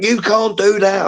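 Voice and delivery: a hint of a ref telling off; stern referee. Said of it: "the referee, he was like".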